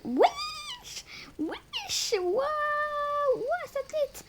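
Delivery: high-pitched squealing shout; shrieking at the screen; excited screaming. Real, not acted. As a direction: squeal it out in delight. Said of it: "je vous signale que je crie là".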